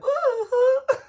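loud high burst of laughter. Real, not acted. Vlogger joking about the music, loud high laugh. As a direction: let a big laugh out.

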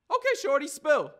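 loud, high confrontation; challenging demand. Acted. Nigel confronting the kid who follows him, challenging.

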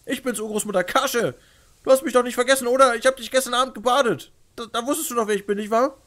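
pleading, loud and high; tragic heroine sorrow. Acted. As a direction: beg her to remember you. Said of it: "redet so flehentlich auf sie ein".

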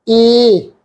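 ਏ held as a long, clear "e" vowel; long e sound. Shown as a demonstration. Teacher sounds out the laan vowel ਏ for students. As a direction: sound out ਏ as a drawn-out "eh". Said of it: "ਇਸ ਢੰਗ ਦੇ ਨਾਲ ਅਸੀਂ pronounce ਕਰਦੇ ਆ".

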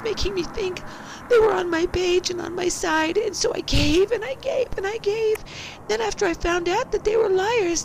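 first of two voices, a high falsetto send-up; mocking voice. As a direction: whine it way up high like a caricature. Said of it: "I shall say, in two different voices".

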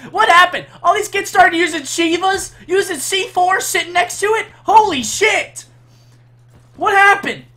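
high loud outraged rant; frustrated yelling. Real, not acted. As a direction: rant at the top of your voice. Raging streamer yelling at game.

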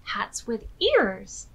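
usual speaking voice

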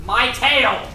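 loud high panicked cry; alarmed shout. Real, not acted. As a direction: scream for help with a plane on his tail. Enemy on his tail.

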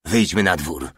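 ordinary delivery

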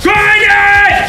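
very high screaming order; furious shout. Pretend. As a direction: scream it at the cook. Chef yelling order.

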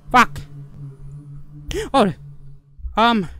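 ordinary talk, nothing marked

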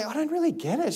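soft, high-pitched mild puzzlement; puzzled wife. Retold or imitated. Wife puzzled by husband's chocoholic habit, lightly voiced. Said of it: "and she'd say".

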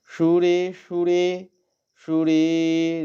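সুরে with the রে held long. Shown as a demonstration. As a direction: stretch the রে in সুরে. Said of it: "রেটাকে দীর্ঘ করব".

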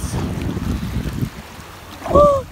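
high breathy gasp as the cold water hits; sharp gasp at cold water. Real, not acted. Stepping barefoot into freezing water, a real sharp gasp. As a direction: gasp from the shock of the icy sea. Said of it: "but it's freezing cold".

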